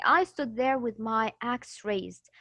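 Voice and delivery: slow reading. Shown as a demonstration. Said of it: "you would say".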